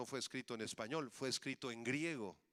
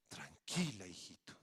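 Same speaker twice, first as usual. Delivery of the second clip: calm grandmother; 7 semitones lower than usual. Acted. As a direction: answer a grandchild with unhurried peace. Grandmother answers, acted calm.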